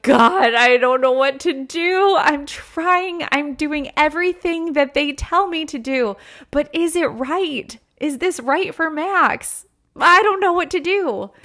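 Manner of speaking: re-enacted desperate plea, pitched high; desperate prayer. Mom re-enacting her crying-on-the-floor prayer to God, desperate pleading. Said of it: "I was desperate, like, ugly, crying on the floor".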